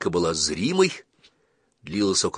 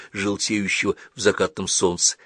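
plain reading voice

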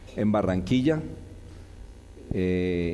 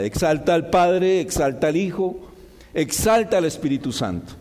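fervent preaching; 4 semitones higher than usual. Acted. Preaching with rising force.

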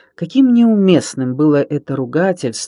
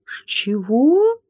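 put-on much higher, thin, outraged little girl; thin indignant; 11 semitones higher, much slower than usual. A narrator's reading. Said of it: "тонко возмутилась Алёнка". (тонко и возмущённо, высоким голосом)

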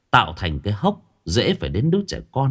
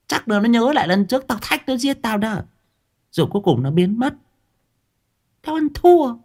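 put-on cursing old woman; 11 semitones higher than usual. Old woman showing how she cursed the ghost, combative. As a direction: rant in a high, boastful old-woman voice.

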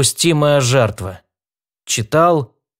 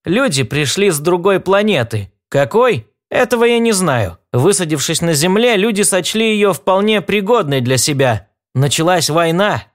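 higher voice for a little talking rodent; small creature voice; 7 semitones higher than usual. Acted. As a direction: play a chatty tiny animal, pitched up. Spider tells history.

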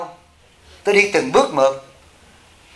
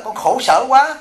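higher, plaintive voice of a rich disciple; imitating a lament; 6 semitones higher, slower than usual. Monk voicing the millionaire confiding his pain, higher plaintive lament. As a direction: mimic the sorry millionaire's moan. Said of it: "Nó là".

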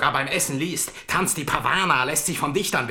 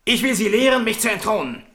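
angry outburst; 6 semitones higher than usual. Acted. King in a rage.

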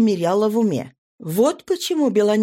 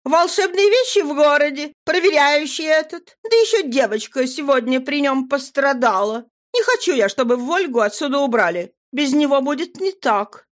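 put-on higher, earnest young girl; 7 semitones higher than usual. A narrator's reading. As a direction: speak up as a girl. Nadira voiced.

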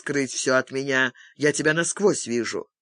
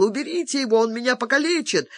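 much higher panicked squeal; shrieking in terror; 9 semitones higher, faster than usual. A narrator's reading. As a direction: shriek for help, wide-eyed. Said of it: "Выпучив глаза, Карпентер завизжал".

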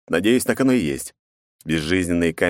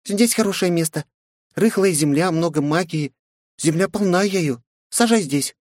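tiny high fairy voice; pixie; 11 semitones higher than usual. A narrator's reading. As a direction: chirp it like a small fairy. Fairy explains the spot.

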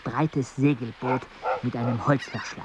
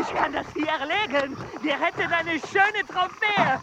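high, eager protest; excited protest; 14 semitones higher than usual. Acted. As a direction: plead to take the shot. Arguing to shoot the jaguar.

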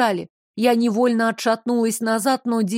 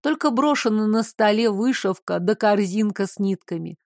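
ordinary delivery

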